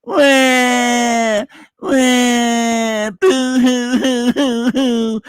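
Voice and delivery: high, fake bawling wah wah, drawn out slowly; hammed-up baby crying to mock; mock crybaby. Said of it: "I'm a little crybaby wimp like this guy here".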